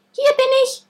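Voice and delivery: tiny high squeak; squeaky voice. A narrator's reading. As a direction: squeak it like a little creature. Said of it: "piepste es von dem Hügel".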